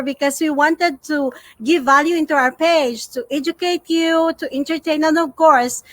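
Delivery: ordinary talk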